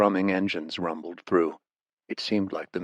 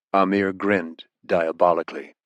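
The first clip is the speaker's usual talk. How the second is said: usual speaking voice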